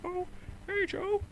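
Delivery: very high falsetto cartoon mouse; high-pitched cartoon impression; Mickey Mouse. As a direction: greet someone in a squeaky mouse voice. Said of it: "do the Mickey Mouse impression".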